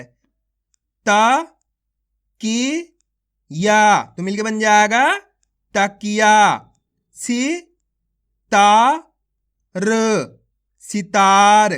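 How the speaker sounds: stretched-out syllable calling, each syllable punched; phonics drill. Shown as a demonstration. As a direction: sound out each syllable slowly for children, then join them. Sounding out syllables into words.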